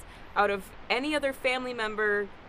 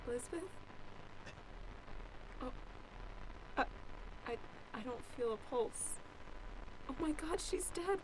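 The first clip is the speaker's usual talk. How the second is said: hushed shock; 16 dB quieter than usual. Acted. Finding the body, shocked.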